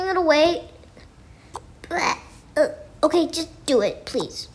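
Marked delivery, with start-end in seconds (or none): none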